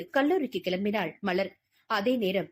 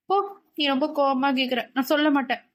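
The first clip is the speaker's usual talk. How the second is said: put-on higher-pitched, pouting girl voice; sulky refusal; 4 semitones higher, faster than usual. Malar refusing to tell her scolding friend, sulky. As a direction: pout and refuse like a hurt friend.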